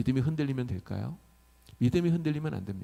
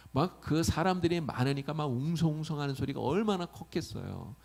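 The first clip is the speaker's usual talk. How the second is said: ordinary delivery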